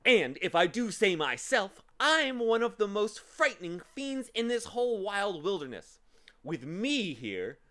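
loud, emphatic storybook threat; big, theatrical monster bluster; menacing boaster. A narrator's reading. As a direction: brag loudly to scare your captives.

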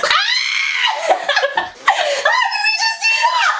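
high, laughing triumphant shouts; excited shrieking. Real, not acted. The lift finally succeeds.

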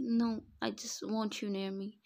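usual speaking voice

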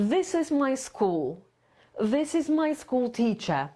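slow, pitch drops at sentence end; falling intonation. Shown as a demonstration. Teacher contrasting two sentences, falling at the end. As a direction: end each line on a fall. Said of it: "opada na końcu zdania".

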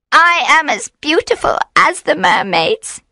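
high, lilting witch voice; high-pitched coaxing boast; alluring witch. A narrator's reading. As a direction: tempt him in a high voice. Witch tries to charm him.

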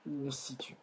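unvoiced whisper to self. Real, not acted. Teacher repeating a phrase to herself, whispered. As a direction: breathe it to yourself.

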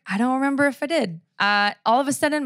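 ordinary talk

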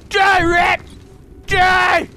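loud high battle cry; loud war cry at rats; battle shout. Real, not acted. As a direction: yell at the rats as you hit them. Fighting rats.

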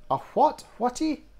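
put-on high, loud puzzled kid; incredulous high-pitched question; baffled daughter. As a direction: blurt it out high, not understanding. Baffled daughter interrupts father's jargon.

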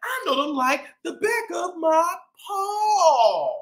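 lively shopkeeper cat telling his story; boastful bodega-boss cat, upbeat; cat narrator Chip. Acted.

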